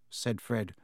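plain reading voice

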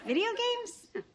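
high, laughing disbelief; surprised. Acted. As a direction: echo it like it's absurd. Incredulous echo of the phrase.

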